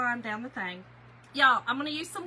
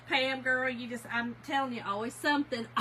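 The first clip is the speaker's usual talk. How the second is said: ordinary delivery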